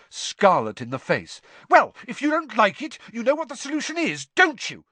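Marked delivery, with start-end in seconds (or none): 1.7-4.8 high, loud, furious bellow; heated high-pitched shouting; angry yell. A narrator's reading. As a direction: yell it across the room in a blazing row. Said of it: "yelled Hermione".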